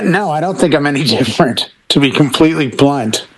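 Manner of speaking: ordinary talk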